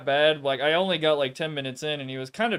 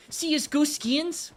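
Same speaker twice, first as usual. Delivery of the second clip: much higher nasally YouTuber voice, rising at the end; mock his nasal whine, lifting up on skins; TDM Jesus nasal accent; 13 semitones higher than usual. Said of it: "he says it like that".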